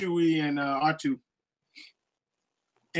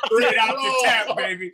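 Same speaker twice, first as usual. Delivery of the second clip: playful hype; 6 semitones higher, 6 dB louder than usual. Real, not acted. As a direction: sell it like a bar tap. Joking about raw milk.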